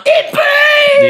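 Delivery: high-pitched shriek of burning; over-the-top victim's shriek; mock agonized scream. Acting out kid hit by vomit.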